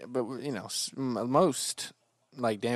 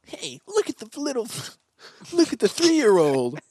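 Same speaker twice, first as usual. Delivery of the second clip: mocking kid voice; 11 semitones higher, 6 dB louder than usual. Playacting kids mocking bus driver.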